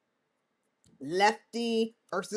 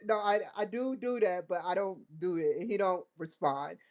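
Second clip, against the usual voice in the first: usual speaking voice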